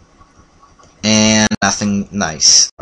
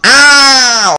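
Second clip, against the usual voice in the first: loud, high drawn-out "ow"; startled cry; 8 dB louder than usual. Real, not acted. Player attacked mid-greeting in the game, startled cry. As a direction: yelp as if suddenly hit.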